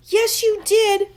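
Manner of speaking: high, loud, slow insistence; crying out. A narrator's reading. Chloe arguing with Max, high loud cry. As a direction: cry it back at him. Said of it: "Chloe cried".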